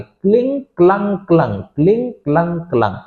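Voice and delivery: क्लिंग said with an i, क्लंग said with a short u; cling versus clung. Shown as a demonstration. Teacher drilling verb forms for students, क्लिंग then क्लंग. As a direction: contrast क्लिंग and क्लंग clearly. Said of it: "इसकी जो second third form है वो क्लंग है".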